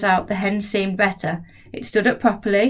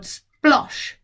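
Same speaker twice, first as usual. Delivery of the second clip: splosh sound; 6 semitones higher than usual. A narrator's reading. Narrator voices the landing.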